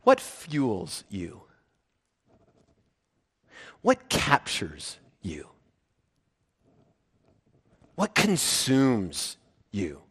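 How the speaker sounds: low, soft, very slow questions; slow, searching. Real, not acted. Preacher asking the congregation what fuels them, searching. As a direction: let each question hang, hushed and slow.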